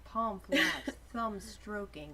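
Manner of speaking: ordinary talk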